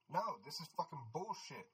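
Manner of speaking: faint, high, far-off shout; muted angry protest from outside; voice calling outside. A narrator's reading. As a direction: yell it from a distance. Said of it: "the voice called out again".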